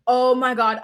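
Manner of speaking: ordinary delivery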